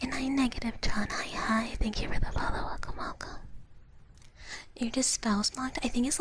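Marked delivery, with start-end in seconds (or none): none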